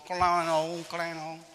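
loud, high mimicked cowbell; drawn-out ringing clang, sung out; imitated cowbell clanging. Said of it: "grote koebel".

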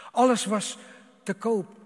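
loud indignant pulpit cry; preaching shout. Acted. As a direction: fling it out to the hall. Preacher cries out Egypt's wealth.

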